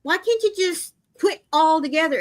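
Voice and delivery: incredulous nagging at a husband; a wife's replayed disbelief at her husband; exasperated. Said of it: "And I'm like".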